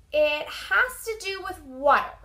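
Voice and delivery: usual speaking voice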